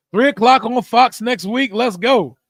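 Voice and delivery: excited announcement. Real, not acted. Streamer hyping the game time, loud and excited. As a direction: announce it like great news. Celebrating big game news.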